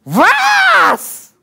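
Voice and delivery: very high, loud cry of disbelief; shocked shout. Acted. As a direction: shriek it out in total astonishment. Acted disciples' shock at recognising Jesus.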